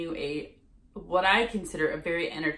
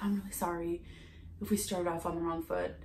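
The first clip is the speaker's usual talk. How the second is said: softer, hushed saleswoman apologizing; quiet apology; 8 dB quieter than usual. Retold or imitated. Vlogger imitating the saleswoman's quieted apology at the door. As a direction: drop to a meek, sorry hush. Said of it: "her voice got quiet".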